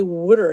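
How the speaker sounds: water with a Philly "wooder" vowel; Philly pronunciation. Shown as a demonstration. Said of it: "Like, we say water here".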